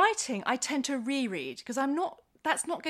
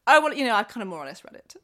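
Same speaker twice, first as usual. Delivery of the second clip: imitating son's hedging; 9 dB louder, faster than usual.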